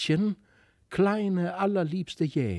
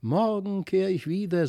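calling from afar; 5 semitones higher than usual. A narrator's reading. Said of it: "rief es aus weiter Ferne".

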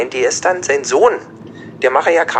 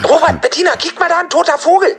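excited call; 14 semitones higher, 4 dB louder than usual. Acted. Pretends to spot dead bird.

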